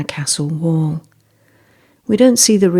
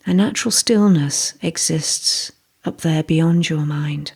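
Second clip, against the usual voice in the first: calm, even meditation voice, settling at phrase ends; guided meditation. Real, not acted. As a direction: guide them gently into stillness, pausing between thoughts.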